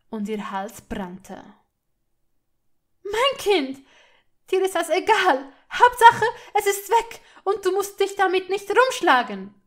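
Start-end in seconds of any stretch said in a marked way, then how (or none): 3.0-9.5 high, loud, bitter accusations; distraught fury. A narrator's reading. Hermine fighting Malfoy for her child, distraught fury. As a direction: cry out at him in anguish. Furious mother shouting accusations.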